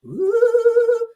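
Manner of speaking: a high, loud falsetto ooh; high sung ooh. Pretend. Vlogger mocking a singer with a high loud ooh. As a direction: belt a shrill-free high ooh like a diva warming up.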